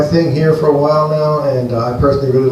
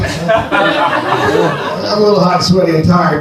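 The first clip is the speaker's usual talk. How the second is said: laughing; 4 semitones higher, much slower than usual. Real, not acted. Innuendo punchline, laughing.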